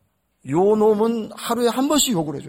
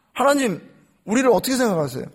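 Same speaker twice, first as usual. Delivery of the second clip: normal talking voice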